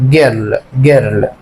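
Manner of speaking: put-on جيرل with a hard G, like girl. Shown as a demonstration. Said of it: "تتحفظ زي ما هي".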